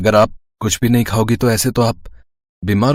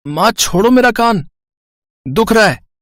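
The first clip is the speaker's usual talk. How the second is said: much higher, pained pleading; whining in pain; 12 semitones higher than usual. Acted. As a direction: beg her to let go of your ear. Mother pulling his ear.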